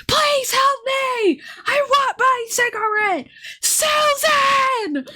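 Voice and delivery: high, wailing calls for Susan; desperate calling out. Retold or imitated. Patient across the hall calling for Susan, high wailing. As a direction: cry out like a distraught patient. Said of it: "who kept going".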